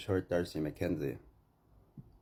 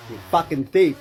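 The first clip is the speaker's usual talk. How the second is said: furious accusation; 8 semitones higher, 11 dB louder than usual. Real, not acted. A robbed man screaming at the thief, furious. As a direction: yell it high and loud, hit "thief".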